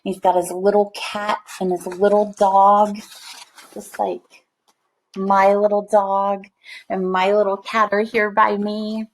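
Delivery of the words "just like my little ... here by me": usual speaking voice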